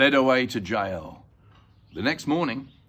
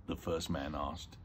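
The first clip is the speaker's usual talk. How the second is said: ordinary delivery, nothing marked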